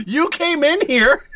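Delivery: high, loud shopkeeper rant; fed-up shopkeeper. Pretend. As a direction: complain like a store owner. Shopkeeper role continues.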